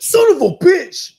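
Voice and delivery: loud, slow furious yell; angry shout. Retold or imitated. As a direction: yell it out in rage. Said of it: "I'd be hella mad".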